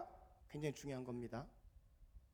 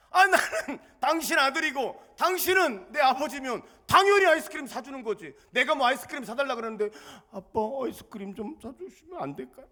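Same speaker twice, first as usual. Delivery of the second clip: put-on laughing paraphrase of child; 9 semitones higher, 13 dB louder than usual. Pastor laughing as he explains his son's logic. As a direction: voice the boy's logic while laughing. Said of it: "너무너무 당당해".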